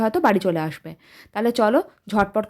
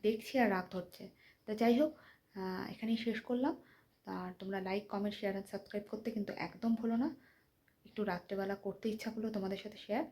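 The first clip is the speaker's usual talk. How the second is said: talking softly; 19 dB quieter than usual. Real, not acted. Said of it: "তাই আস্তে আস্তে কথা বলছি". (ঘুমন্ত বাচ্চাদের জন্য আস্তে আস্তে বলা)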